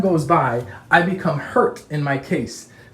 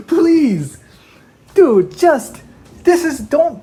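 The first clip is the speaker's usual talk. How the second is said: higher and louder in exasperation; exasperated; 9 semitones higher, 6 dB louder, much slower than usual. Real, not acted. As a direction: throw up your hands, half shouting. Exasperated outburst at the absurdity.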